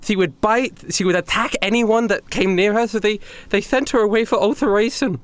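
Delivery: a hint of a worried captive; lightly voiced Heather. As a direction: Heather telling Bradley about his sister. Heather explains Selena's fate.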